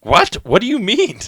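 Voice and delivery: high, loud startled what; shocked disbelief. Acted. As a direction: squeal out the confusion. Acted confused boyfriend reply.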